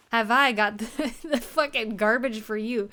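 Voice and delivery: loud laughing boast. Real, not acted. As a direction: laugh through a gleeful brag. Laughing while handing over junk.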